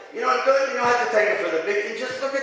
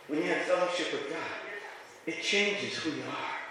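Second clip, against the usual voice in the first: hushed and intimate; 5 semitones lower, 11 dB quieter than usual. Real, not acted. Preacher lowering his voice so the congregation leans in, hushed. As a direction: let it sink in quietly.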